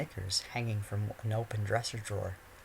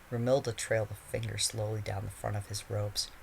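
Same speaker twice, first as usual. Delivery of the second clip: ordinary delivery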